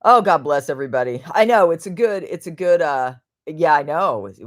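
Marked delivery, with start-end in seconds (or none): none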